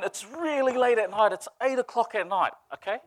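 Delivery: ordinary talk, nothing marked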